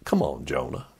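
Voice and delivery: quiet, low, weary sigh of a line; a hushed, let-down God; soft disappointed God. Retold or imitated. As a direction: let him down gently, disappointed. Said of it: "God says".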